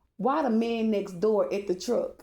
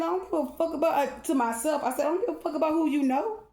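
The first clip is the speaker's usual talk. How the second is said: 1 retold angry retort; 7 semitones higher, faster than usual.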